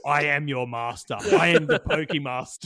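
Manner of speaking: loud, overbearing proclamation; hammed-up tyrant declaring power; mock domineering master. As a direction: lord it over a cowering servant. Imagined owner lording over captive creature.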